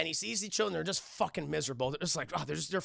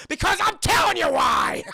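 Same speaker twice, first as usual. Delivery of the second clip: high, loud unhinged shout; acted manic outburst; crazed yell; 12 semitones higher, 11 dB louder than usual. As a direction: explode like a man losing it. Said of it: "he's a little crazy".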